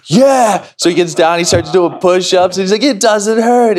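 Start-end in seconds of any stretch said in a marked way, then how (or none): 0.1-0.7 high, loud eager shout; excited kid. Retold or imitated. Healed boy eager to do push-ups. 2.8-3.8 put-on high, thrilled little-boy cry; imitated freaking out. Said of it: "freaking out".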